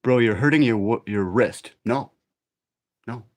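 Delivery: ordinary delivery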